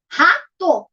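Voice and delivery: RATO split into two beats, RA, TO; syllable by syllable. Shown as a demonstration. Teacher counts the two syllables for pupils, syllable by syllable. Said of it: "tem duas sílabas".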